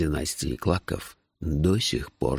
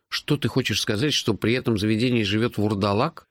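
higher-pitched incredulous questioning; incredulous listener; 7 semitones higher than usual. A narrator's reading. Narrator's hero questions his friend about the tavern owner, incredulous.

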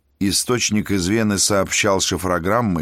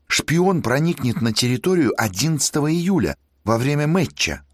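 rising higher and excited; excited student; 5 semitones higher than usual. Acted. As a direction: speak faster as excitement builds. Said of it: "Мальчишка был сильно взволнован".

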